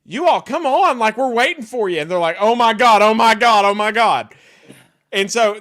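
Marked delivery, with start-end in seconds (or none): 0.0-2.0 high, loud, urging call; full-on egging on. Retold or imitated. Prankster hurrying friends to the airport, hyped up. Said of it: "and I'm just full on". 2.4-4.3 loud, breathless-run repetition; imitating frantic friends. Friends rushing to ride the chopper, loud mock frenzy.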